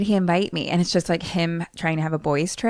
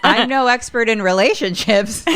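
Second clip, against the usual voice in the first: laughing through the words; 3 semitones higher, 4 dB louder than usual. Real, not acted. Co-host dodging the relationship question, laughing. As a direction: break up laughing as you admit it. Laughs at own lack of answer.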